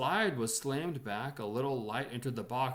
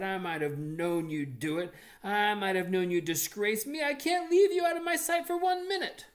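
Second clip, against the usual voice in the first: rising shriek, much higher and louder; furious sister; 10 semitones higher, 9 dB louder than usual. A narrator's reading. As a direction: scold him, climbing to a screech. Sister rages at disgraced brother.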